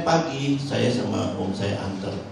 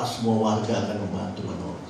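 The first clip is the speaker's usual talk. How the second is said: normal talking voice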